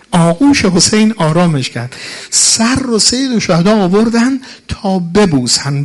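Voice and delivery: loud, high wailing sermon chant; mourning lament. Acted. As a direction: cry out the martyr's plea to the crowd. Rawza lament continues.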